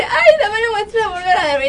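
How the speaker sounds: high, loud, whiny begging; hammed-up lovesick whine; retelling the boyfriend's line, high and mocking; mock boyfriend pleading. Boyfriend voiced again, higher, laughing.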